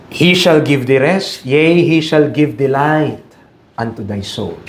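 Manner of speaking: slow, low Bible recitation; scripture reading. Acted. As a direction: draw out the blessing slowly. Slow solemn verse reading.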